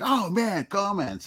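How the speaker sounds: high-pitched retold delight; lightly acted thrill, voice up high; mock excitement. Acting out own earlier reaction.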